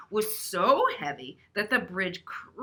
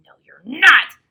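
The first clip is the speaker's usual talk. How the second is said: troll roar; 4 semitones higher, 17 dB louder than usual. Acted. Troll voiced in story.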